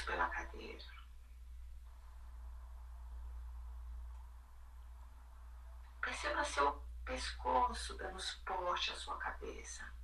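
calm, hushed, sinking into quiet; soothing spa whisper. Acted. Leads a guided body meditation.

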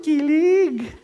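usual speaking voice

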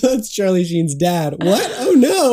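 laughing. Real, not acted. Laughing while retelling the story.

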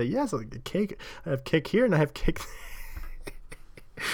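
Polish-accented mimicry, breaking into giggles; excited Polish accent. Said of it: "talking excitedly with what I can only".

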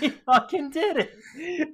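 high, slow, laughing exclamation; laughing disbelief. Real, not acted. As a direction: marvel at it through laughter.

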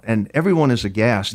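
ordinary talk

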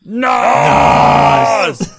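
huge, high, hammed-up yell; drawn-out shout. Hosts redo the franchise catchword properly, shouting it theatrically. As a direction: belt it out long and loud. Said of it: "we didn't say it right".

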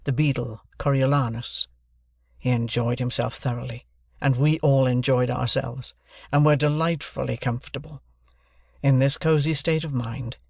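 usual speaking voice

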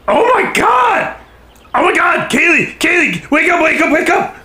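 high frantic yelling; panicked shouting. Acted. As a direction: shout her awake in panic. Yelling at unconscious friend.

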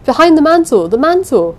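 loud, high desperate cry; trapped woman shouting to searchers. A narrator's reading. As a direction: call out for help again and again. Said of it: "I shouted".

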